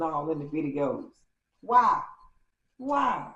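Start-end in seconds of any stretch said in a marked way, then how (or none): 1.6-3.3 louder, higher cries of why; anguished why. Real, not acted. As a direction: plead with the ex. Heartbroken over the ex's videos.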